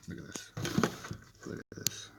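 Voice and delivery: whispered to the camera; hushed, breathy aside. Real, not acted. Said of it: "whispering to camera".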